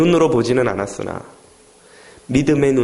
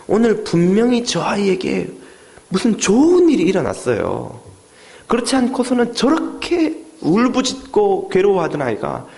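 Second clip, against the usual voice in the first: marveling patient; 6 semitones higher than usual. Retold or imitated. Hospital patient speaks to father.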